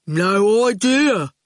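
slow, heavy-witted thug, words dropped one by one; menacing henchman taking his time; slow lumbering thug; slow goon voice. Acted.

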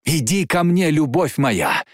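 high, urgent calling; impassioned cry. Acted. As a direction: cry out to a lover, pitch climbing. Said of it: "крикнула Джейн".

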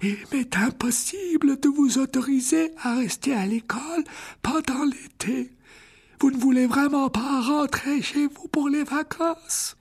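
put-on high, slow, kindly old wizard voice; an elderly headmaster gently refusing; frail old headmaster. A narrator's reading. (voix aiguë et lente de vieux directeur)

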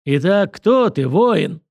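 lightly mocking examiner; taunting interrogation. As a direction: draw out the question, challenging. Director grills the newcomer.

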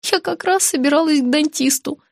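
high, resigned little voice; heavy sigh. A narrator's reading. Patient giving in to friend, sighing. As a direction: say it with understated weariness. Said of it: "тяжело вздохнула я".

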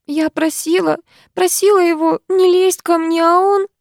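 high-pitched, tearful pleading; acted distraught girl breaking down. A narrator's reading. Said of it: "И она снова разрыдалась".

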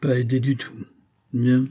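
vague, distracted musing; preoccupied, trailing remark; absent-minded. A narrator's reading. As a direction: say it as if thinking of something else. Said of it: "d'un ton tout à fait distrait".

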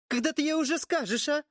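put-on high, agitated demanding girl; angry and upset. A narrator's reading. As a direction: press him, angry and anxious. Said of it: "разгневанная и взволнованная девица".

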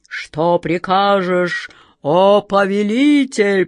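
громко, медленно, гулко; прогреметь как могучий джинн; громовой голос джинна. Acted. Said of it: "прогремел он". (громоподобный бас джинна)

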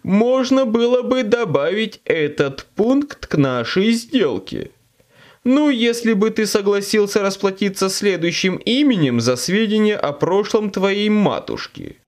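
greedy grating demon; devil. Acted. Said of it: "Голос демона отвратительно скрипел".

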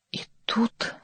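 faint trembling whisper; barely audible, frightened; weak unsteady whisper; weak, unsure voice. A narrator's reading. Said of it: "голосом настолько слабым и неуверенным".

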